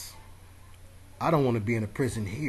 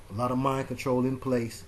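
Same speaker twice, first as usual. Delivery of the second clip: usual speaking voice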